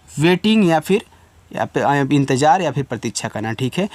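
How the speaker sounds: normal talking voice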